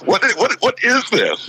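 high-pitched, stuttering mock disbelief; stammering bewilderment. Security guy after the hug, high stammering bewilderment. Stammering baffled guard, acted.

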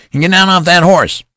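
put-on high, loud boyish fury; ferocious voice. A narrator's reading. Boy general threatening the amused colonel, high ferocious shout. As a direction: spit it out like an outraged child. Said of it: "in a ferocious voice".

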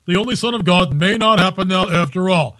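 ordinary talk, nothing marked

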